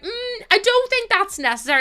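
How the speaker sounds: a hint of a prim older sister; prim big sister. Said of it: "she's like".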